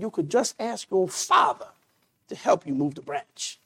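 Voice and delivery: soft, hushed fatherly advice; gentle father. Acted. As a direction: lean in and offer the answer quietly.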